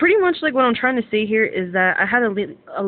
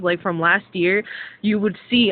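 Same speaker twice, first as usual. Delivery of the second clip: usual speaking voice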